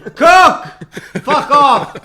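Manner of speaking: yelled, high and loud; shouting. Real, not acted. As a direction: shout it in protest. Shouting the name in protest.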